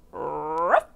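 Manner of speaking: rolled growl rising into a bark; dog's ruff. A narrator's reading. Storyteller voicing the pig's mistaken sound, growly dog bark. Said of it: "he let out a".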